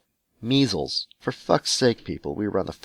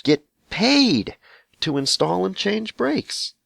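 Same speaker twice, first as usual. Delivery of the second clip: indignant emphasis; 12 semitones higher, 3 dB louder, slower than usual. Acted. Ranting letter writer exposes mechanics.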